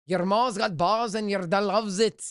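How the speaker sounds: rolled R's, a hammed-up Scottish try; mock Scottish accent. Streamer trying a Scottish accent for a chat viewer, rolled R's. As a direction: roll the R's in "ma's" like a Scot. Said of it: "they roll the R's".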